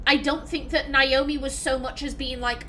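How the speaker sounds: normal talking voice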